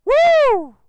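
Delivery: a loud, high whoop of thrill; excited whoop. Real, not acted. Rider whooping at viewers after a hard rev, loud high whoop. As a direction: let out a real whoop as the bike pulls.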